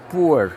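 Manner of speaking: poor said with a u sound, like pur; poor pronounced pur. Shown as a demonstration. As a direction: say poor with a u vowel. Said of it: "como se fosse P U R".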